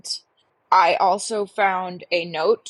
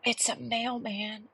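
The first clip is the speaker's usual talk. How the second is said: ordinary talk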